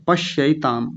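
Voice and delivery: पश्यैताम slow, with a clear ऐ; careful pronunciation. Shown as a demonstration. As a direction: sound out पश्यैताम. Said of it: "ऐसे".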